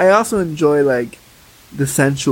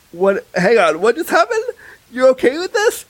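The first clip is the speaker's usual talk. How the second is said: rising higher in retold shock; half-acted amazement climbing higher; stunned disbelief; 9 semitones higher than usual. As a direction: replay your own stunned double-take. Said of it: "And I went".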